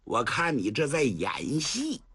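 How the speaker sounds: scorn her, then explode; vicious abuse. Acted. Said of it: "薛丁山又来劲儿了". (恶狠狠地骂到狂吼)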